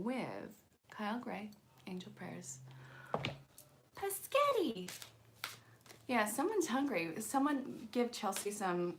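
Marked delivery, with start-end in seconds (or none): 4.0-4.9 "Paschetti" squeaked out high, a playful mangling of spaghetti; mock squeaky Paschetti. Reader teasing hungry Chelsea in chat, squeaky "Paschetti". Mocking chat's spaghetti talk.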